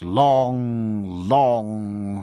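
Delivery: "long, long" with each word greatly stretched; both words "long" held at length for comic effect; drawn-out emphasis. A narrator's reading. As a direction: draw out each "long" on a falling tone. Narrator stretches a comic description.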